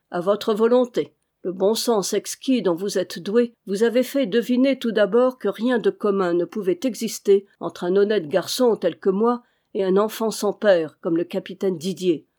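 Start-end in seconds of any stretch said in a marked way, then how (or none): none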